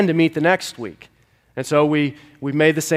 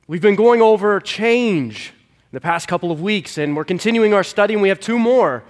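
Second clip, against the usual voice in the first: preaching; 4 semitones higher than usual. Acted. Animated sermon announcements.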